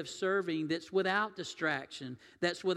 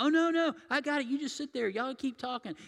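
high, bright-eyed hostess brushing off help; eager hostess; 8 semitones higher, 4 dB louder than usual. Retold or imitated. Hostess insisting the guest sit down, high and eager. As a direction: insist cheerfully they just sit.

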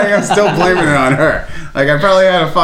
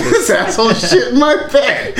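laughing mock disgust; 7 semitones higher than usual. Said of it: "She's like".

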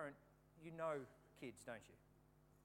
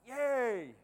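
much louder cheer; cheering yay; 5 semitones higher, 14 dB louder than usual. Retold or imitated. Fight fans celebrating, a loud cheer. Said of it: "They were like".

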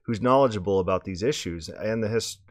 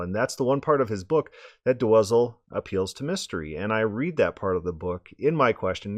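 normal talking voice

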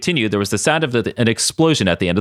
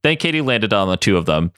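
ordinary delivery

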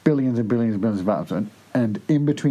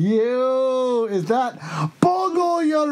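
silly high voice; 12 semitones higher, much slower than usual. Acted. Teasing high put-on voice.

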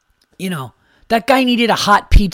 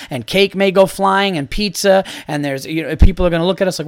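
usual speaking voice

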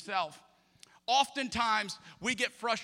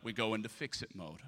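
usual speaking voice

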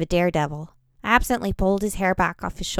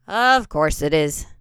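resigned sigh; 3 semitones lower, slower than usual. Acted. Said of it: "Bannon sighed".

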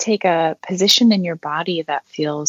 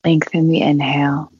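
unhurried meditation guide with long pauses; guided meditation. Acted. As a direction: guide them gently through breathing, leaving long silences.

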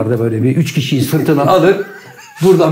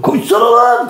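mock yell; 8 semitones higher than usual. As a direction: yell at him to run. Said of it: "bağırıyordu".

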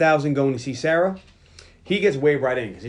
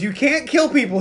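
high-pitched imitated outrage; hammed-up aghast protest; mock scolding; 10 semitones higher, 4 dB louder than usual. Boy scolds Terminator over killing, high mock outrage.

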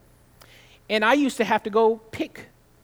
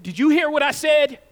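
higher, louder mock snap at the worker; grumpy customer snapping; 6 semitones higher, 8 dB louder than usual. Rude customer snapping at the sandwich maker, mock angry. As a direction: act out a rude customer demanding attention.

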